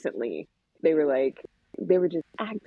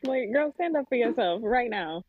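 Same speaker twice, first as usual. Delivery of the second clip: higher-pitched, insistent scolding; pleading order, voice pitched up; mock urging; 7 semitones higher than usual. Mom telling girl to stand up, insistent.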